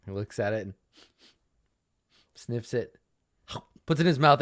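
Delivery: ordinary talk, nothing marked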